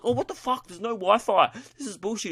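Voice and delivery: high-pitched acted indignation; mock outrage. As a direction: play a worked-up complaint, pitched high. Acted outburst at having no Wi-Fi.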